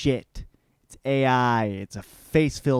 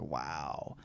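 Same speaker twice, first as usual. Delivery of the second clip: slow, hushed and appalled; shocked whisper; 3 semitones lower, 6 dB quieter, much slower than usual. Retold or imitated. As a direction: whisper it in disbelief. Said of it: "Basil's like".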